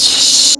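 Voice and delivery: long voiceless shush; hushing shush. Acted. Cora shushes the freed captive, hushing. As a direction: hush someone with a hissed sh. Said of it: "Cora just goes".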